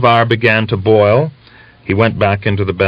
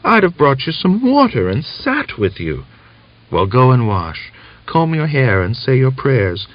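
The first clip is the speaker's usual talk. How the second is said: higher voice of a sick wife on the mend; relieved but frail, pitched up; weak young woman; 6 semitones higher than usual. A narrator's reading. Natalia answers daughter.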